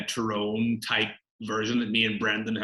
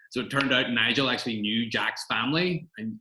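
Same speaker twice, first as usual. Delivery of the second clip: normal talking voice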